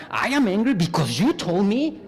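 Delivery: mock angry complaint. Shown as a demonstration. Teacher recalling the first, angry option, mock blaming. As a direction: blame them with heat. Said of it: "like this".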